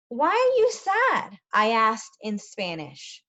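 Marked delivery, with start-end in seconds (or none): 0.1-1.4 gentle question. Acted. Asking a sad child.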